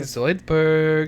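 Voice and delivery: drawn-out cartoon character impression; Zoidberg. Co-host demonstrates how the character says it, drawn-out impression. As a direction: stretch the name out in a character voice. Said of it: "But he says it like that".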